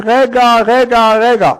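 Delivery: retold urgent cry to stop the hanging; calling out wait. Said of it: "התחיל לצעוק".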